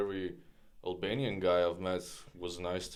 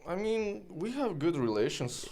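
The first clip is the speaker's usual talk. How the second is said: pitched up high, insisting; high emphatic; 12 semitones higher than usual. Real, not acted. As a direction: jump up high to stress the point. Guest insists on good relations.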